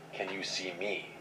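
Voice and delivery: slow, hushed, ghostly voice; eerie whisper-like voice. A narrator's reading. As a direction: ask it softly like a lurking ghost. Eerie voice in the dark.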